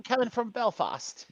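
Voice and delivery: slow, mock reporter in a Belfast accent. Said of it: "that my very posh Belfast accent".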